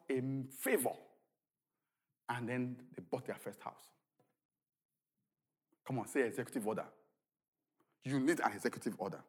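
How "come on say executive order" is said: ordinary talk, nothing marked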